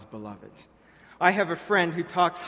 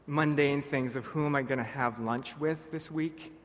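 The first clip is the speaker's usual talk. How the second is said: usual speaking voice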